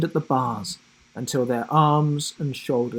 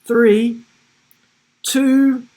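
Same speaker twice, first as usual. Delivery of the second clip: higher and louder with each number, building; enthusiastic shouted countdown; 10 semitones higher, 8 dB louder, much slower than usual. A narrator's reading. Boy counting down loudly for his friend, building with excitement. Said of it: "shouted Will enthusiastically".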